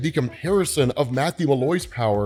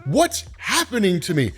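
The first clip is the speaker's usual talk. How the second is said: higher, scared question; retold panic, pitched up; panicking; 5 semitones higher than usual. Man overwhelmed by his power, asking in panic. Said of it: "That's why he's panicking".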